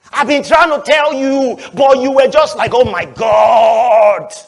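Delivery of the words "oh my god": loud, very slow, hammed-up gasp of joy; mock excited cry. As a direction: ham up the overexcited preacher. His excited self mimicked.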